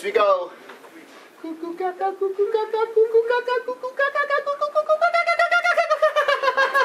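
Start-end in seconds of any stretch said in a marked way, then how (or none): 1.4-6.8 high, rising kookaburra cackle that climbs ever higher; laughing bird call, pitch climbing to a squeal. Shown as a demonstration. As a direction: chatter like a kookaburra, faster and higher. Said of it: "so it goes like this".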